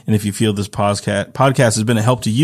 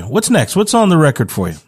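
usual speaking voice

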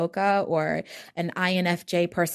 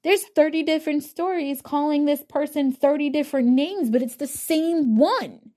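sudden realization; 8 semitones higher than usual. Retold or imitated. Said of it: "you actually realize".

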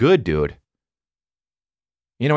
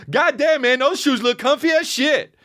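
mock booming bathroom shout, pitched up; loud guy in bathroom. Stranger yelling about comfy shoes, mock booming voice. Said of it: "I just hear some guy say".

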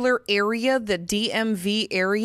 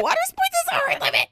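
even higher, breathless laughing take; laughing repeat; faster than usual. Real, not acted. Repeating the joke to her friend, squeaky laughing voice.

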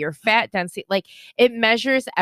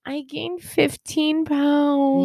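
drawn-out confession; 5 semitones higher, much slower than usual. Real, not acted. Confessing the weight gain reluctantly.

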